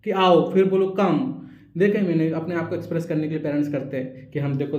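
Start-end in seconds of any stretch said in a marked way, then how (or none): 0.0-1.4 put-on slow call to a child, switching words; mixed-language call. Shown as a demonstration. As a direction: call slowly, mixing Hindi and English. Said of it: "आओ मत बोलो फिर आप".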